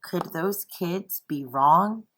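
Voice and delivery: plain reading voice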